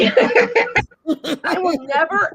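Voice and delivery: laughing through the words; can't stop laughing. Real, not acted. Aunt laughing at her own story, laughing through it.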